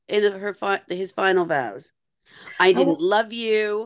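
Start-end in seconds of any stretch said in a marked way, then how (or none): 2.6-3.8 slowed, cold mock-cruel man; lightly voiced heartless ex; a hint of [name]'s mean Duncan impression. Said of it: "the voice she does when she does Duncan being mean".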